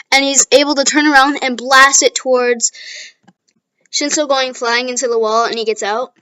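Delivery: ordinary talk, nothing marked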